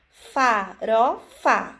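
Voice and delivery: farofa drawn out very slowly, each syllable clear; slow, over-articulated. Shown as a demonstration. Teacher to a child who cannot read, slow and clear. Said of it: "enfatizando cada sílaba".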